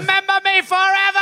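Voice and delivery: overacted death scene. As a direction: ham up a last farewell. Mock dying farewell cry.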